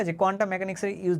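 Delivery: ordinary delivery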